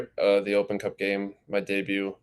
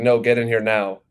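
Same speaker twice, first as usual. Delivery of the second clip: mild mock yell, louder; imitated parental yelling; 3 semitones higher, 7 dB louder than usual. Parents calling son into living room, mildly raised voice. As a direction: lightly imitate parents ordering their son in. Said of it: "they were just yelling at me".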